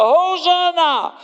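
slow, high, loud cry of praise; cheering crowd. Acted. The same crowd once cheered, high and loud. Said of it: "die geroepen hadden".

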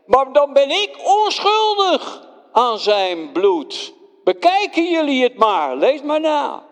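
wash your hands of it in public; Pilate declares. Acted. Pilate declares his innocence loudly before everyone. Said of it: "Ik zei".

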